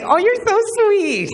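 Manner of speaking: very high, slow, loud mock-girl squeal; hammed-up swooning falsetto, stretched out; gushing girlfriend. Girlfriend melting at his answer, high mock gushing.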